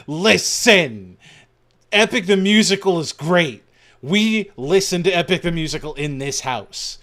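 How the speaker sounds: loud emphatic rant, pounding every line; retold scream. Wife yelling at her husband about the musical, loud rant. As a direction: shout it like laying down house rules. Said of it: "my wife just screamed".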